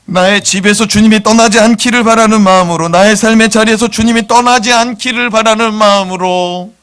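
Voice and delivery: full-voiced sermon appeal; fervent preaching. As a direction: plead with the congregation.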